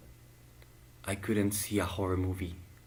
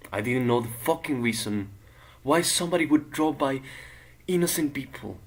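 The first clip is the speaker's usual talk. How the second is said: louder, higher burst of indignation; indignant, emphatic outcry; outraged; 4 semitones higher, 5 dB louder than usual. Acted. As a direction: protest the senselessness with rising force. Outrage at the killing of innocents.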